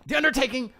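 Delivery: high, loud hype shout; exclaimed band name. Real, not acted. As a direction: shout it like a headline with an exclamation point. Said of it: "it has an exclamation point at the end".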